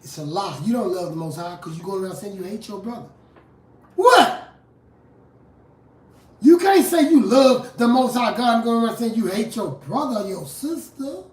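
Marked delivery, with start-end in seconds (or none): 3.9-4.5 sudden high, loud exclamation; incredulous outburst. Real, not acted. Preacher reacting to a hypocrite's claim, loudly incredulous. 6.4-11.2 emphatic rebuke. Real, not acted. Rebukes hypocrisy after outburst.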